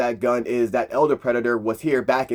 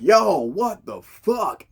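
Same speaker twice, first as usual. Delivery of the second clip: very slow, drawn-out stunned line; shocked disbelief; 5 semitones higher, much slower than usual. Retold or imitated. Aldani seeing the Predator kill, slow stunned outburst. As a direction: stretch out the shock. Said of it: "Aldani's like".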